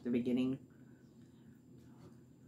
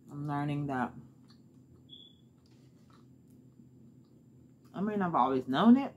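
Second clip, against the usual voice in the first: chewing while talking; speaking between bites; mouth full of chicken; 3 dB louder than usual. Real, not acted. Seen 0: Bites chicken off bone at 0.6, food in mouth, then chews.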